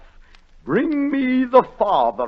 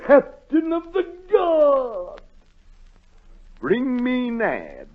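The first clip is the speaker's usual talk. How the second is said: high, slow shout; a king bellowing for his guard; royal summons; 11 semitones higher, 4 dB louder, slower than usual. Acted. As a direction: call the guard across the hall.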